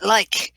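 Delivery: "like" with a long i and k; pronunciation like. Shown as a demonstration. Reader offering a third pronunciation of lich, long i. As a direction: say "like" to rhyme with bike. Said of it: "what is the proper pronunciation".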